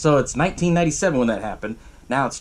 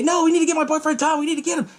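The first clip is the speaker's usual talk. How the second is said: high-pitched mock pleading; frantic pleading; 13 semitones higher, faster than usual. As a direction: imitate a frantic girlfriend's protest. Sarah pleading for her boyfriend.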